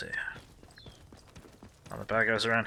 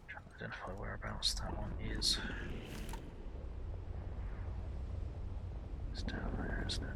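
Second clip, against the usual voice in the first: sneaky hushed whisper; 10 dB quieter than usual. Real, not acted. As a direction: whisper so nobody hears. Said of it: "sneaky time".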